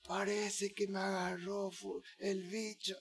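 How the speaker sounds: soft, feeble voice of a sick man; weak sick voice. Retold or imitated. Pastor mocking himself sick with covid, soft and feeble. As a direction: sound like covid knocked you flat.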